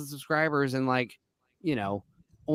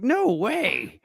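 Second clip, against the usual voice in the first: high-pitched reenacted astonishment; mock-shocked exclamation; retold disbelief; 9 semitones higher than usual. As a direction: act out your disbelief. Retelling own shocked reaction.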